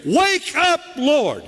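high, desperate cry to a sleeping Lord; panicked plea. Retold or imitated. Disciples in the storm begging Jesus, high panicked cry. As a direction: beg him to wake up, voice cracking high. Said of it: "they do something that you and I have done".

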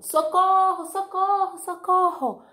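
high, loud cries for help; loud panicked calls; screaming for help. Acted. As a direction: call out in a fright. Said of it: "saiu gritando".

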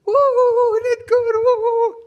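very high, loud whooping; hammed-up shrieking cheer; mock celebrating. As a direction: whoop it up in self-congratulation. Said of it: "stop celebrating with it just for ourselves".

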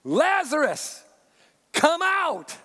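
high, slow, drawn-out call to the tomb; calling out loud. A narrator's reading. Jesus calling Lazarus from the tomb, high, slow ringing call. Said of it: "Jesus called out in a loud voice".

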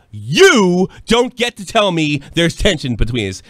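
slow, loud rebuke to the patron; slow pointed confrontation. Retold or imitated. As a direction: lay down the line, word by word. Swinging chair round to confront.

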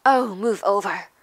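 loud, impatient snarl. A narrator's reading. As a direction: shove them aside with your voice. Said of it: "Hermione snarled".

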